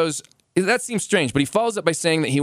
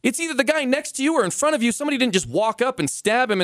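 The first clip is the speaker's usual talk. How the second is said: exasperated; 10 semitones higher than usual. Real, not acted. Exasperated explanation, pitch high.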